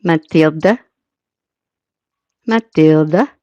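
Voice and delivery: "Matilda" echoed slowly with a questioning rise; copying a pronunciation. Retold or imitated. Streamer copying the Norwegian playback, tentative imitation. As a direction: try out "Matilda" as played, voice lifting at the end. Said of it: "it really wants to do it this way".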